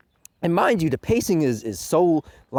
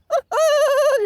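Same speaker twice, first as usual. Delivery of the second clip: sharp high burst of laughter; a quick squealing laugh; 6 dB louder than usual. Real, not acted. Laughing at fans.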